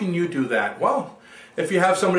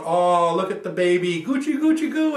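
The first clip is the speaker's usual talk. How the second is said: put-on cooing baby talk. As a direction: coo like he's talking to a cute infant. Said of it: "here's Henry Winkler looking at him going".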